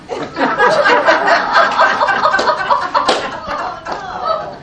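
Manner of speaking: high, breathy stretch of laughter; long real laugh. Real, not acted. Laughing at his own word victim, long laugh. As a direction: laugh it out at your own joke.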